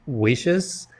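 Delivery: ordinary talk, nothing marked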